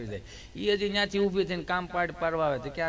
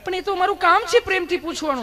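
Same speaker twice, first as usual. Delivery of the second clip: much higher, louder sugary nurse voice; insistent sweet nurse; 11 semitones higher, 6 dB louder than usual. Retold or imitated. Nurse repeating her line to Gabho, higher and louder. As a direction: insist sweetly, pushing harder. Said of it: "nurse બેને".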